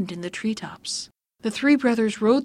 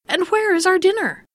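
higher, louder demanding question; indignant demand; 9 semitones higher, 5 dB louder than usual. Acted. Hungry brothers to Evening, indignant demand. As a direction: demand the missing meal. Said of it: "they exclaimed".

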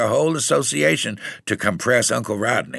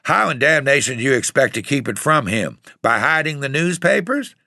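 raised, exasperated questioning; mildly hollered outrage; cussing and hollering; 6 semitones higher than usual. A narrator's reading. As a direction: demand it indignantly with climbing pitch.

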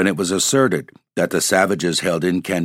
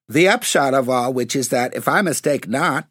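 sturdy; 7 semitones higher than usual. A narrator's reading. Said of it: "said Tom sturdily".

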